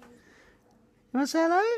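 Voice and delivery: high, slow coaxing coo. Real, not acted. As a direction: coax the dog to say hello. Coaxing the dog.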